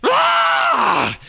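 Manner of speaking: high, loud startled scream. A narrator's reading. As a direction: scream in fright. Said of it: "screamed Albert".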